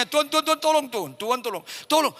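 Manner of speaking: high, panicky repeated cries for help; imitating a terrified wife; frightened pleading. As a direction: beg God in mounting fear. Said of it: "makin takut istrinya".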